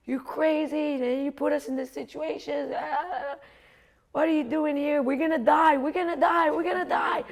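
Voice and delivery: high, hammed-up wail of panic; panicked yelling. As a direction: freak out like a hysterical inner voice. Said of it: "she's yelling".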